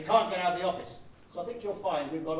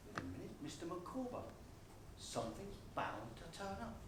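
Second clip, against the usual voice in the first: much softer, quicker, thinking aloud; quiet musing aside; 18 dB quieter than usual. Acted. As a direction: let the idea dawn under the breath. Idea dawns, muttered aside.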